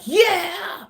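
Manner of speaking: very high, drawn-out rock scream; rock star yell. Acted. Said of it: "a rock star or someone that's really like".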